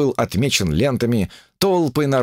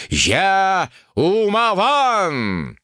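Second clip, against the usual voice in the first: Умаван drawn out much higher; triumphant proclamation; 10 semitones higher, much slower than usual. A narrator's reading. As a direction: cry Умаван high and long, in triumph. Climactic self-naming.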